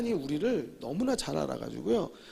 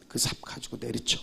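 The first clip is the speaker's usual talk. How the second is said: demonic whisper; 6 semitones lower, 7 dB quieter than usual. Retold or imitated. Demon voice urging murder.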